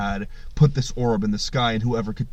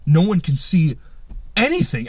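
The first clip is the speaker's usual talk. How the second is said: usual speaking voice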